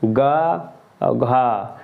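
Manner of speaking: very slow गा light, then घा with a strong puff; contrasting ga and gha. Shown as a demonstration. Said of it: "गा पर कम जोर दिया". (धीरे-धीरे गा हल्का, घा जोर से)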